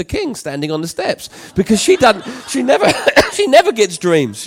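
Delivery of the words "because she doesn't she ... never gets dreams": loud, laughing through the words; high, loud amusement with a chuckle; amused laughing. Real, not acted. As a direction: break into a laugh mid-sentence. Amused at wife never dreaming.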